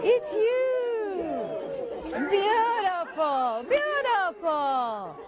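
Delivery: high gasps, laughing, slow joyful praise; gasp, laugh and savour it; delighted greeting. Shown as a demonstration. Speaker leading the audience, high laughing delight.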